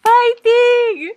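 high, loud cheering yell; mock fan shout. Imitating a cheering fan shouting encouragement.

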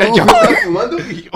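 very high goofy voice; cartoon voice. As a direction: squeak it like a cartoon. Joining the Goofy impression.

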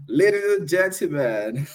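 high, loud ring announcer; frantic mock sports commentary. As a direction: boom it out like a ringside MC. Live fight-night intro.

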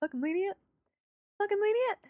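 high, hushed disbelief, imitated; pissed off. Retelling June's answer to the commander, pissed off. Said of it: "she looks so pissed".

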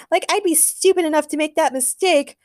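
put-on high, scornful girlish retort; scoffing. A narrator's reading. Supergirl denies taking the wrong man, scoffing. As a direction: toss off the denial with contempt. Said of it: "scoffed Supergirl".

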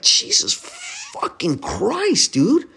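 very high, slow, drawn-out Jesus fucking Christ; incredulous, dragged-out exasperation; exasperated disbelief. Real, not acted. Exasperated at a trade proposal.

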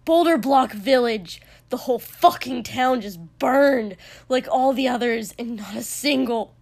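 loud, heated outburst; raging, hard-hit delivery; enraged shouting. A narrator's reading. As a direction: yell the news in fury. Enraged report of burned village.

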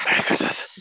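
fully whispered, creepy festive greeting; eerie little imitation whisper. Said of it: "he does a creepy little whisper".

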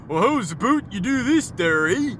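high, sing-song parody of Canadian speech; exaggerated Canadian "aboot, eh"; mock Canadian accent. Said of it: "the Americans will counter".

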